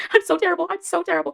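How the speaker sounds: high, loud, cracking up; real laughing. Real, not acted. Fan confessing thirst to viewers, laughing at herself. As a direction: laugh helplessly through a self-mocking confession.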